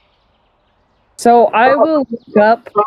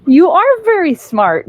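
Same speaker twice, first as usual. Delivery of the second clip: higher, encouraging praise; 8 semitones higher than usual. Acted. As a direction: speak it clearly to a little creature. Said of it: "I'll say it out loud".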